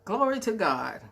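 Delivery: normal talking voice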